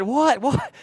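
high, loud retold "what?"; baffled husband, voice jumping up; startled disbelief. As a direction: act out being thrown by the news.

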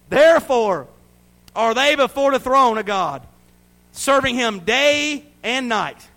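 loud, high proclamation of scripture; declaimed scripture reading. Real, not acted. Preacher reading the throne vision to the church, loud declamation. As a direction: ring it out over the congregation, with long held words.

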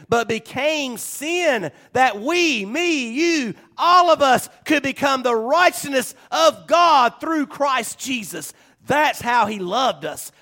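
rising preaching climax. Acted. Pastor telling the church Christ became sin, fervent climax. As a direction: lift it to a fervent peak.

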